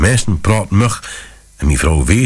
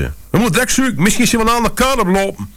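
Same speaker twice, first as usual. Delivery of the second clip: high, panicked woman's voice. A narrator's reading. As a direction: plead high and frantic. Wife panicking about lost child. (hoog en paniekerig)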